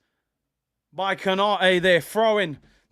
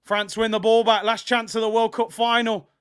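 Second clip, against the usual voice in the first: ordinary talk, nothing marked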